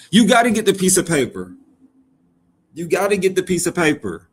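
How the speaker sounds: loud, high insistent declaration; emphatic yell. Acted. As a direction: hammer the point home loudly. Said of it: "Why you yelling".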